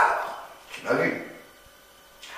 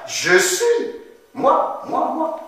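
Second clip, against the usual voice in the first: acted joy; 11 semitones higher than usual. Shown as a demonstration. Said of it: "Très bien".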